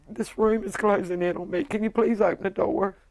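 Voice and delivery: retold panic; panicked plea. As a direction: beg for air as panic sets in. Panicking in the small room.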